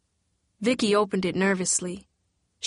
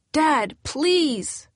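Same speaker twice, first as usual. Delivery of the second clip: desperate plea; 7 semitones higher, 3 dB louder than usual. A narrator's reading. Vicky begs absent father.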